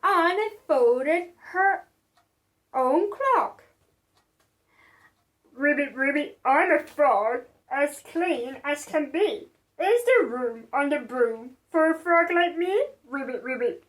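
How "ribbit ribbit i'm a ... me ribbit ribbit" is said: low croaky frog voice. Acted. The frog asking for room on the broom, croaky. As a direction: speak like a wet frog. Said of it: "said with a croak".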